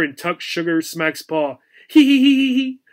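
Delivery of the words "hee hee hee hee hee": high, loud giddy giggling; cat giggling as her paw is tickled; hysterical giggles. A narrator's reading. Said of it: "the tiger cat giggled loudly".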